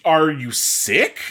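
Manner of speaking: normal talking voice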